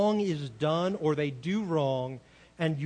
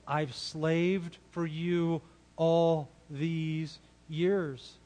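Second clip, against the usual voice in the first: very slow, drawn-out complaint; imitating a long-suffering older son, slowly; aggrieved older son; much slower than usual. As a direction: draw out each word of the grievance. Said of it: "his story that he believes is what?".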